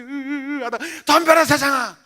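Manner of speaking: high challenge surging up; defiant battle cry. Retold or imitated. Pastor back to facing the world, defiant cry. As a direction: swell from a wail into a yell.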